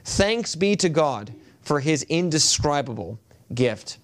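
normal talking voice

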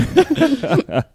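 short high nervous giggle; nervous laugh. Real, not acted. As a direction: let a nervous giggle slip out. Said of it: "That's a nervous giggle".

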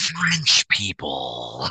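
very low, slow, drawn-out mock French; snooty French voice. Host mocking the French segment, low slow sneer. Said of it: "mocking French".

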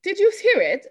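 high, loud mock English accent; put-on English accent. Host checking co-hosts heard the D, in mock English accent.

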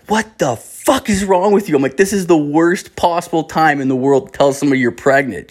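high-pitched, exasperated outburst; outraged scolding. Retold or imitated. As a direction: re-enact telling off someone after a cruel prank. Retold angry scolding after cruel prank.

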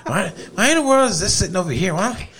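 hint of the wife's complaining, through laughs; mock grumbling wife. Husband mimics wife fussing over the toaster, mock grumbling. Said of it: "I've heard her mumble".

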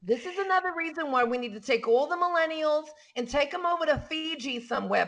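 normal talking voice

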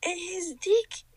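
high, incredulous storytelling; retold shock. Telling co-host what appeared beside her, retold shock. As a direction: relive the shock in a high pitch.

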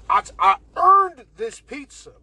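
loud, high, defiant yell on earned; defiant shout. Pretend. Telling imaginary critics he earned the pizza, defiant shout.

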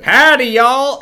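high, loud mock Southern accent. Showing the easiest accent to fake, mock Southern. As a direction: belt out an exaggerated Southern greeting. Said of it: "and the southern one".

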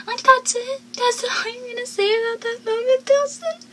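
high, put-on voice of mock disbelief; incredulous jab. Vlogger mocks Dawson for brushing Joey off, high indignant voice. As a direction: scoff at him as if he were in the room.

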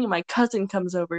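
ordinary talk, nothing marked

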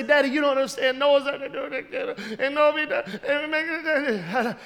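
imitating a tearful little girl; put-on whine of a daughter tattling to daddy; mock crying. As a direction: blubber a child's complaint, half garbled. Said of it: "start be crying and say".

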